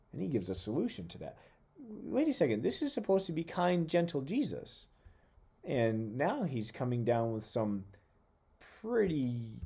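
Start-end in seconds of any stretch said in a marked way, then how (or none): 1.8-4.9 high, indignant pretend objection; mock protest. Preacher voicing a listener surprised by stern Jesus. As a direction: play the doubter who feels cheated.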